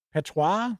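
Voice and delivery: "Patois" said tentatively; unsure pronunciation. Real, not acted. Reading a skill list, unsure how to pronounce "Patois". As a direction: try "Patois" as an unfamiliar word. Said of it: "I don't know how that's pronounced".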